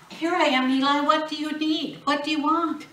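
put-on high, loud eager boy; a boy rushing in, bright and keen; high, loud eager boy answering. Boy answering more urgently.

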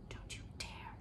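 fierce whisper; whispered command to the fading light; whispered order. A narrator's reading. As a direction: order it under your breath. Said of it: "she ordered in a whisper".

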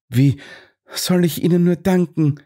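high, airy thanks; breathy relief. A narrator's reading. Miss Claxton thanks her rescuers, high and airy. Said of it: "hauchte Miss Claxton erleichtert".

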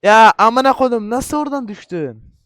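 loud, high burst of disbelief; outraged yell. Real, not acted. Gamer shouting at himself for falling, outraged yell. Yells after falling in game.

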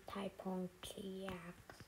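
slow breathy whisper of odd name. Real, not acted. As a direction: whisper the strange name slowly. Mumbling odd save names.

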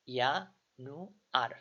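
"ইয়ানুয়ার" very slowly, starting on a Y sound; spelled out slowly. Shown as a demonstration. Tutor slowly sounding out German January for students. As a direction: draw out "ইয়ানুয়ার" syllable by syllable. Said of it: "তার মানে কি হয়েছে ইয়ানুয়ার".

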